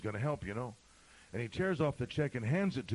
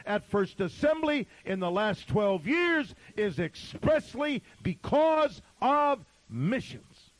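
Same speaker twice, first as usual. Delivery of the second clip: loud, high-pitched pulpit shout, drawn out toward the end; impassioned preaching; 10 semitones higher, 9 dB louder, slower than usual. Real, not acted. Pastor to deacons about missions, impassioned preaching. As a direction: preach it at full force, hammering each phrase slowly.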